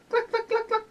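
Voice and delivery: quick high hen clucks; chicken clucking. Acted. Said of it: "look at that chicken".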